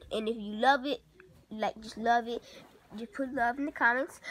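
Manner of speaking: ordinary talk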